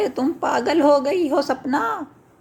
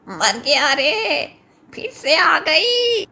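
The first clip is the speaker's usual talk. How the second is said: slow, rising pained wail; crying out in pain; 2 semitones higher, slower than usual. Acted. Chintu wailing as the cramp returns, slow and pained. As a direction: whimper as the cramp hits.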